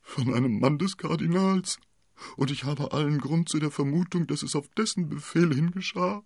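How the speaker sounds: high-pitched, plaintive accuser; hammed-up wretched husband; whiny landlord. A narrator's reading. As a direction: pour out suspicions in a high whine.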